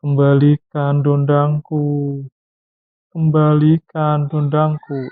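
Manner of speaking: put-on slow, wailing plea of a ghost child's mother; crying ghost. Father imitating the ghost daughter, slow mournful wail. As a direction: draw it out like a weeping spirit. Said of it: "Sambil nangis".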